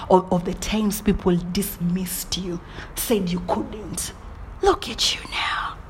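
soft unvoiced intimate whisper; hushed motivational whisper. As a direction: lean in and breathe the words. Whispers encouragement to viewer.

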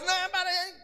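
breaking into tears. Acted. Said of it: "começou a chorar".

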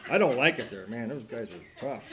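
usual speaking voice

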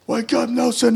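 high, teasing wake-up call; mocking buddy. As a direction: sing out his name high to rouse him. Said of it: "he'd be like".

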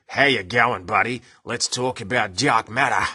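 full-on broad Australian; exaggerated Australian accent. Shown as a demonstration. Host showing listeners complete Australian, exaggerated Australian accent. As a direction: lay the Australian accent on thick. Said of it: "speaking completely Australian".

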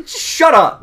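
high loud fed-up shout; mock yell. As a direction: yell shut up, exasperated. Retold yelling at dad.

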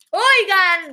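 grito agudo imitando a una compañera; llamado indignado. Retold or imitated. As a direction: llamar fuerte, exigiendo atención. (grito de protesta, imitado)

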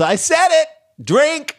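high, loud gleeful cry; proud outburst. Real, not acted. Host pleased with his own joke, in a gleeful high cry. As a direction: crow it like you won.

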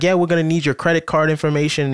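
lightly imitated Indian accent; a hint of an Indian caller accent. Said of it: "And they're like".

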